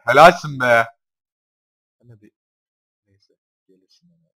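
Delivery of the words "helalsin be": "Helalsin" much louder than the first time; loud sarcastic praise. Real, not acted. Gamer praising his Pokemon, loud mock cheer. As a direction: cheer it out mockingly.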